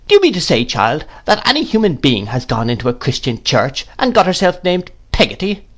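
high, incredulous great-aunt; mock outrage rising on the name; indignant. A narrator's reading. Miss Betsy objects to the servant's name, indignant. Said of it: "with some indignation".

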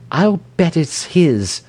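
high, drawn-out exclamation; high-pitched cry of suspicion. A narrator's reading. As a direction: cry it out as a knowing accusation. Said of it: "cried Mrs. Goyte".

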